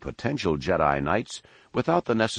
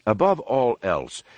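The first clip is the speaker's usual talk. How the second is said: ordinary delivery, nothing marked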